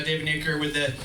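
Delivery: normal talking voice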